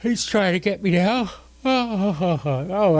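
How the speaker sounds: talking through a yawn. Real, not acted. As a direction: let a yawn stretch the words. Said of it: "I'm sorry I'm yawning in your ears".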